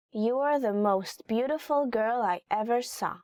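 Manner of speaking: normal talking voice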